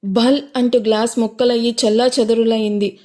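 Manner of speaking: usual speaking voice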